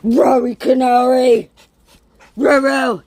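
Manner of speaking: loud call across the yard. Real, not acted. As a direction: call the dog loudly. Calling out to the dog.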